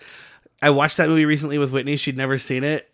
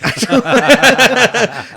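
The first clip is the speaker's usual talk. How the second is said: high, loud cackling laugh; loud laughing fit; 8 semitones higher, 9 dB louder than usual. Real, not acted. Host cracking up at nun hat, loud laughter. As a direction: burst out laughing loudly.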